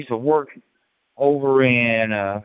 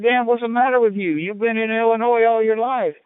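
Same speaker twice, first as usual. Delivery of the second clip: voicing his mother, higher; mock mom, pitched up; his mother scolding, raised pitch; mother quoting; 10 semitones higher than usual. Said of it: "She said".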